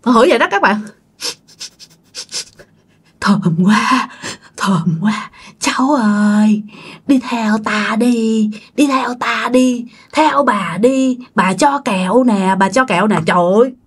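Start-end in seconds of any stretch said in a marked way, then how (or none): 3.2-13.2 slow, eerie drawn-out coaxing; luring ghost woman. A narrator's reading. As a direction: lure a child away, stretching every word. Ghost woman lures the child away. (giọng bà ma dụ dỗ, kéo dài)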